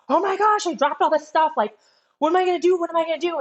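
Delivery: high, frantic mock panic; retold panic. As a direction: squeal it out like you've lost everything. Retelling panic over lost cards.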